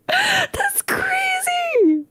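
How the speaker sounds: very high, drawn-out squeal; long high wail of amazement; amazed squeal. Real, not acted. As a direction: squeal it slowly in disbelief. Amazed at what he said.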